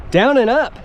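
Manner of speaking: high, amused punchline; amused emphasis. Real, not acted. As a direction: land the joke with a lift. Trail name proves literal.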